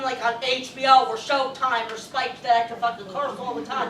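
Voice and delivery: ordinary talk, nothing marked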